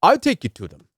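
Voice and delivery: imitating a Haitian accent, insisting; a light Haitian accent, badgering a traveller. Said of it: "I'm gonna do my best to do the accent".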